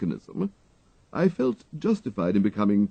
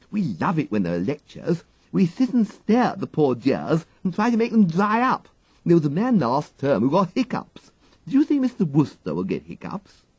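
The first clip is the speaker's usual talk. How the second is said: put-on higher, quick mischievous girl; gleeful schoolgirl; 10 semitones higher, 5 dB louder than usual. A narrator's reading. The girl gleefully tells the valet about the lecture, mischievous child. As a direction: relish the lark, breathless child.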